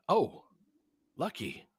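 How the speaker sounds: ordinary talk, nothing marked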